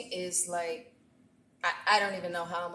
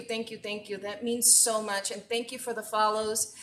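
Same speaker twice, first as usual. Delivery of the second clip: ordinary talk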